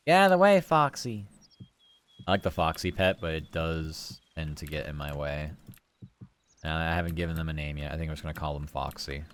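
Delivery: normal talking voice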